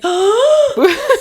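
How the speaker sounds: sharp high shocked gasp; imitated gasp. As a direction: gasp like a shocked museum crowd. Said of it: "se oye el audible gasp".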